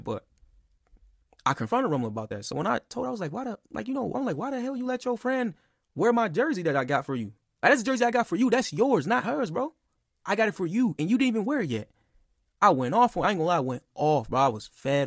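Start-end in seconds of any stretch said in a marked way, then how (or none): 3.3-11.9 heated confrontation. Retold or imitated. Confronting girlfriend about the jersey.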